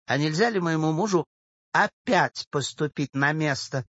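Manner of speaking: sweet, delicate request with a smile; coquettish. A narrator's reading. Old woman asks a job for her husband, coquettish. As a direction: ask coyly, as if for a small favour. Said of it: "даже кокетливую улыбочку, спросила".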